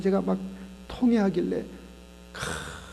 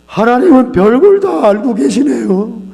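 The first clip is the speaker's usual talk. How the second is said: loud, slow, higher wailing confession; crying out; 6 semitones higher, 16 dB louder, slower than usual. Retold or imitated. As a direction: cry it out, overwhelmed. Husband confessing in tears. (통회하며 크게 울부짖듯)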